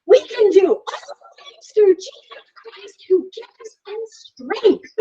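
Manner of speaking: put-on high, slow, triumphant child voice; proud kid. Son at the top of the hill, high and proud. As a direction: declare it like a proud little boy. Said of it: "he goes".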